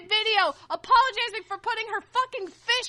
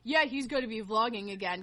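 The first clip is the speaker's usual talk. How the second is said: mocking dad; 11 semitones lower, 6 dB quieter than usual. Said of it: "his dad comes out and announces".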